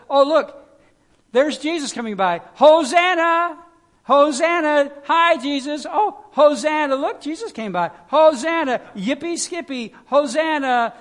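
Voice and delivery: high, loud, giddy cheering; mock cheering crowd. Preacher mocking people who think Hosanna is a cheer, giddy yelling. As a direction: ham up a cheering crowd waving at a celebrity. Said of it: "That's not, that's not this word at all".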